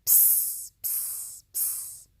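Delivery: soft unvoiced psst sounds; whispered psst. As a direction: hiss a secret psst. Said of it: "They whispered".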